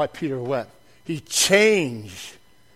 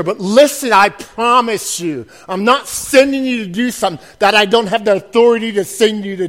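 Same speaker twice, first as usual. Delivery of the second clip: loud, driving preacher cadence; rally the congregation with a booming promise; preaching at full force; 5 semitones higher, 8 dB louder than usual. Acted. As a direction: preach it out, louder with each line.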